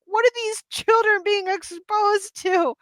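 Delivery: high-pitched scandalized parent; mock parental alarm. Parent recounting children near racy game content, mock-alarmed. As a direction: voice your parent brain in exaggerated dismay. Retells her parent brain turning on.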